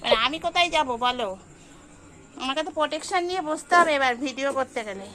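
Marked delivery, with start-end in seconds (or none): 0.1-1.3 exasperated. Real, not acted. Loud exasperated plea to cat.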